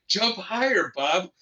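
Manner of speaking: high-pitched imitation of urging kids; drawn-out, high mock-kid yell; kids cheering on. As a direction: egg him on like an excited child. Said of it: "and the kids going".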